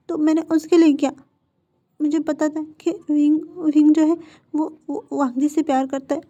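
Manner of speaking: high, pleading confession; desperate begging. Acted. Captive confesses and begs for release.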